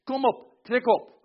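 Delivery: high, loud summons; God calling to war. Acted. God stirring the nations up, loud high summons. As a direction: rouse them to march, high and loud. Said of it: "En zegt".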